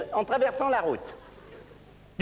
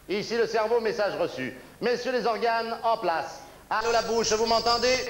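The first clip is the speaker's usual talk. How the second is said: low, commanding control-room voice; brain voice; 3 semitones lower than usual. Acted. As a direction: bark orders like a mission chief. Brain character giving orders.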